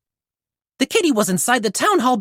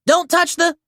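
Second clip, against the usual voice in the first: high, cut-off warning yell; 8 semitones higher than usual. Acted. Zuma yelling at the kitten from the water. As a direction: shout a warning and break off. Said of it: "Zuma yelled".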